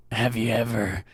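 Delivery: put-on low, soft, winded old man; Clint Eastwood. Podcaster mocking an old actor out of breath, low wheezy impression. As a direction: imitate an old actor gasping between phrases. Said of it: "So out of breath".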